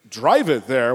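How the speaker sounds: loud, incredulous echo of a suggestion; retold annoyed disbelief; indignant echo. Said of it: "I got a little aggro".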